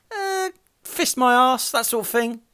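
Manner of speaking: high, slow, whiny slogan reading; mock stall man. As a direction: wail it out like a sheepish vendor. Stall man names his slogan.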